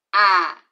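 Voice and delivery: "A" held open and loud; sounded vowel. Shown as a demonstration. Teacher modelling the vowel, loud. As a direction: sound "A" clearly, wide open. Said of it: "Fala comigo".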